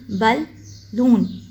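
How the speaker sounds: बलून slowly split as बल, लून; two separate beats in बलून; broken into pieces. Shown as a demonstration. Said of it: "टुकड़ों में करके".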